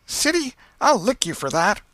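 put-on angry boy warning his brother; high, loud boyish threat at the door; Tom threatening. A narrator's reading. Tom threatens Sid leaving.

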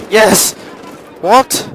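loud high yell, then startled question; cheer, then cry out incredulous; triumph turning to disbelief. Real, not acted. Gamer cheering then baffled mid-match, high loud outburst.

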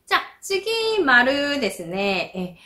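ordinary talk, nothing marked

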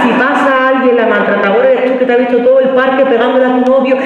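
ordinary talk

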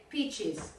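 Peaches with a clear final s; careful teacher correction; plural s stressed. Shown as a demonstration. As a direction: land the s at the end of Peaches. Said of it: "Te faltó la S de plural".

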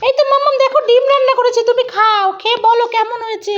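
put-on high, quick little-kid voice; proud child figure. Toy child serving mamma eggs, in a quick high voice. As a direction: offer it eagerly in a high kid voice.

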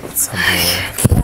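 breathy hushed whisper, very slow. Real, not acted. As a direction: let the news out in a whisper. Whispering the reveal result.

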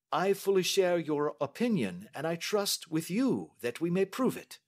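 high, assured detective's voice; Holmes. Acted. Detective agreeing with the young lady, courteous and assured.

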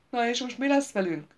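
high, loud whining lament; wailing. A narrator's reading. Gaunt wailing to the group about their fate. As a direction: wail it like a frightened noble. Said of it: "siránkozott Gaunt".

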